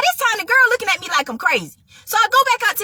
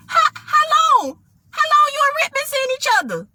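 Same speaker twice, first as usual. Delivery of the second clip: high-pitched, halting then rushed question; acted-out alarmed questioning, pitched up; anxious disbelief; 9 semitones higher than usual. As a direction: stall on "how long", then blurt it out. Anxiously asks how long they dated.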